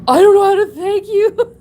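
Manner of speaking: high, loud laughing-crying gush of thanks. Retold or imitated. Retelling thanking the stranger who caught her dog, laugh-crying.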